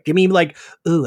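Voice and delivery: ordinary talk, nothing marked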